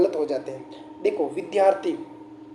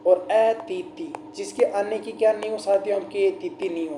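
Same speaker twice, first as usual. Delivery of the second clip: ordinary talk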